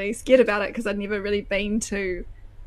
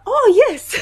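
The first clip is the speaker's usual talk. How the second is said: shop assistant; 14 semitones higher, 10 dB louder than usual. Retold or imitated. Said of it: "she's like".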